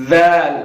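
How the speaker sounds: ذال said softly and clearly; soft dhal. Shown as a demonstration. Teacher modelling ذال. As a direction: say ذال gently, tongue light. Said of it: "نرمی برتنی ہے".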